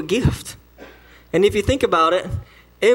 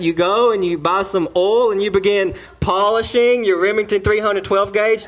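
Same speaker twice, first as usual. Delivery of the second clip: ordinary talk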